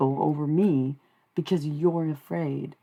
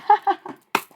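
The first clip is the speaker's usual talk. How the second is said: high-pitched, louder burst of laughter; 6 dB louder than usual. Real, not acted. Amused at possible channeled guest.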